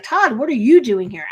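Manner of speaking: loud, bouncing mock challenge; indignant surprise. Jen spotting Todd at the retreat, indignant. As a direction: imitate an affronted confrontation.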